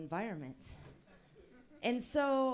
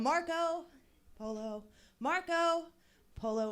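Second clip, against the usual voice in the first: higher calling sing-song Marco Polo; 7 semitones higher than usual. Retold or imitated. Daughter calling through the store, sing-song. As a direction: call out across the store for mom. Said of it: "My daughter walks around".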